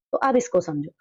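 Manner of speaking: ordinary talk, nothing marked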